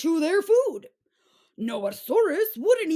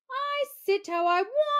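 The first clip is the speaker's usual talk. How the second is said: defiant; 10 semitones higher than usual. Acted. Dinosaur tipping chair talks back.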